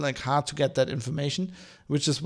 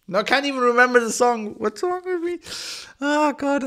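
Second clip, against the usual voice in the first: frustrated at himself; 11 semitones higher than usual. Real, not acted. Podcaster to listeners, stuck on a name, exasperated and high. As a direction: pitch jumps way up in real exasperation, can't remember. Can't recall the band name.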